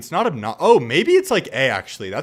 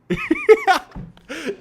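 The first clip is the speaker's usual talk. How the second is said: high, loud burst of laughter; real uncontrolled cackle; helpless laughter; 7 dB louder than usual. Real, not acted. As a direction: crack up with a shrieking high laugh. Cracking up at the clip.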